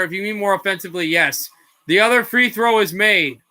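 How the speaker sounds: energetic basketball call; broadcast-style play-by-play; live play-by-play. Acted. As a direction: ride the action as it happens.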